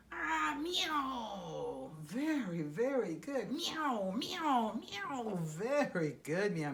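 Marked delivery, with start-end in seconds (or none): 0.1-1.9 much higher, cute meows; high playful kitty meows; kitten puppet meowing. Pretend. Puppet tries the sneeze. 3.5-5.4 much higher, cute meows; high playful kitty meows; kitten puppet meowing. Pretend.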